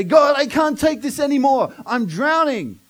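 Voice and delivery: desperate prayer. Retold or imitated. Preacher crying out to God, loud and desperate. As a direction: plead to God at breaking point.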